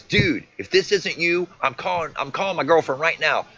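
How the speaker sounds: high, loud acted-out threat; heated ultimatum. Boyfriend threatens to phone his girlfriend as proof, heated. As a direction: imitate a worked-up boyfriend laying down a challenge.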